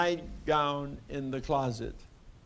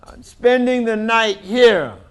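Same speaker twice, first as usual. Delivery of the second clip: loud, firm, high; defiant shout; 7 semitones higher, 11 dB louder than usual. Acted. Daisy standing up to her family, shouting. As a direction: insist on it at full voice.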